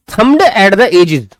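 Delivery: very slow, word by word; slow reading aloud. Shown as a demonstration. Teacher reads the phrase slowly for the class. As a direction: draw the phrase out for students.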